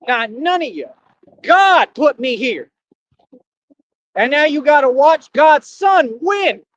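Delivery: slow, loud and high, shouting it out; righteous slow shout; preaching shout. Real, not acted. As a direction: hammer each word like a sermon climax. Preaching rant to viewers.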